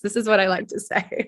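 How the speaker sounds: hoarse, getting over a cold; raspy recovering voice; husky voice. Real, not acted. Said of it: "This is my sexy husky voice".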